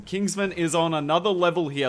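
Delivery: ordinary talk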